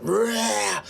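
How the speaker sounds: high, drawn-out roar; monster roar. Acted. Demonic commander Neros building up to his second form, roaring. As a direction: roar like a demon starting to transform. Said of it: "with a roar".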